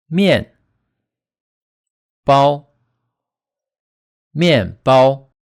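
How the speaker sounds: 面 falling sharply, 包 high and flat, first apart, then joined as 面包; fourth plus first tone. Shown as a demonstration. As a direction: demonstrate 面 dropping and 包 sustained high. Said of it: "ending first tone is high and flat".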